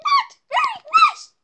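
very high, squeaky protest; offended squeal. Acted. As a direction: protest in a piercing little voice. Offended contestant scolds the host.

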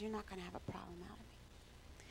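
barely audible whisper; whispered reply. Retold or imitated. Newcomer answering his interrogators, imitated meek whisper. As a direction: answer the challenge in a hushed, submissive whisper. Retelling a man's humbled reply.

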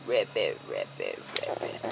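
low croaking ribbits; frog croak. Shown as a demonstration. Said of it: "What does a froggy say?".

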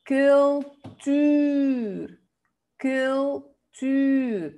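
cultuur, split in two, short "kul", long rounded "tuur"; model pronunciation. Shown as a demonstration. Teacher contrasting single and double U in "cultuur", slow split syllables. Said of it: "Ese suena".

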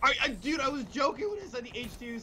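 high-pitched, stammered disbelief; incredulous protest. Real, not acted. Player whose joke about the drone came true, incredulous.

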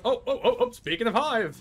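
high, loud burst of excitement; excited discovery. Real, not acted. Player suddenly spotting a hive, excited shout. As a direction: blurt it out as you spot it.

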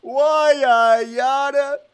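loud, high, slow Why I oughta; tough guy impression. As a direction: shout it like a cartoon gangster. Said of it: "Can you do an impression".

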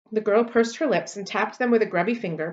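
plain reading voice

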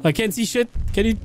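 usual speaking voice

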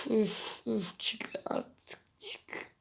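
slow breathy whisper, begging the game; slow hushed begging; whispered plea. Real, not acted. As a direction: whisper it under your breath, pleading. Pleading with the game quietly.

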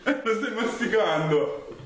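laughing. Real, not acted. Teasing partner chewing marshmallows.

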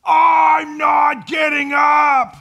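put-on high, slow, wailing shout of a grumpy kid; child's whiny yell. Parent imitating a sleepy child's angry wailing refusal. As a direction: wail it like a kid refusing to get out of bed. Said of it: "what you get back".

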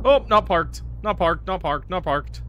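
loud, high startled repetition; alarmed. Real, not acted. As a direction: blurt it out in a mild panic. Car rolls away, startled.

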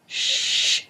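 sharp hissed shh; hissed shush. Acted. Teacher hushing two boys from his desk, a sharp hiss. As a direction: hiss the class quiet. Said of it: "hissed Mister Martinez".

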